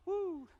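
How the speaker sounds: high-pitched "woo"; mock-covetous whoop. Mock longing for a donkey.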